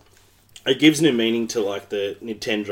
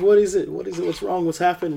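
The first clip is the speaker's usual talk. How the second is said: raised-pitch worried questions, tumbling out; mock concern, higher voice; retold alarm; 9 semitones higher than usual. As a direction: act out an anxious reply to a panicking partner. Retold alarmed reply to crying partner.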